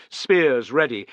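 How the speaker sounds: usual speaking voice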